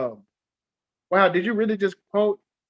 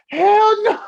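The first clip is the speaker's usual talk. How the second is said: high, loud "hell no" of disbelief; incredulous high yelp; loud high outburst; 8 dB louder than usual. Real, not acted. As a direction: shout it up high in shock. Reacting loudly in disbelief.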